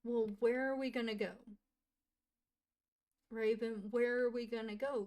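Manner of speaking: frantic asking where they will go; upset outcry. Pretend. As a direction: blurt it out in alarm. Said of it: "she's like".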